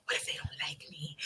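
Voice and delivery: whispered worry, low and private; hushed nervous inner voice; anxious whisper. Retold or imitated. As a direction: whisper the fear to yourself. Retelling anxious self-doubt.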